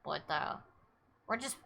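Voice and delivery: ordinary delivery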